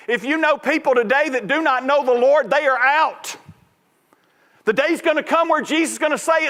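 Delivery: high, urgent pulpit delivery; impassioned sermon, pitched high throughout; full-force preaching. Real, not acted. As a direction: press the warning home to the congregation.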